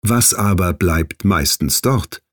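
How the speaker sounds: usual speaking voice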